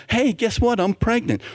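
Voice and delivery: acted girlfriend breaking news; happy announcement. Pregnant woman tells her boyfriend, happy announcement.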